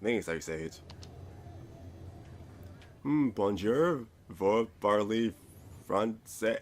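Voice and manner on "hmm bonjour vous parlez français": slow, careful schoolbook French, higher and louder; greeting a biker in shaky French; halting French. Acted. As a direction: sound it out like a nervous tourist. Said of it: "in the language of Moliere".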